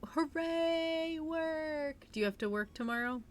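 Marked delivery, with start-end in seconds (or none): 0.0-2.1 high, mock-excited hooray; mock cheer. Mock cheer about going to work.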